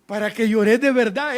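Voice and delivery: loud, hammed-up scolding; aunt's mock threat. Preacher quoting the aunt who spanked, loud mock threat. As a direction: scold like a stern aunt in imitation. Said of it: "me decía mi tía".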